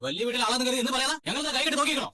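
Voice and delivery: high-pitched indignant outburst. Acted. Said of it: "എന്തിനാ നീ ഇങ്ങനെ ഒച്ച വെക്കുന്നത്?". (ഒച്ചയുയർത്തി ദേഷ്യത്തോടെ)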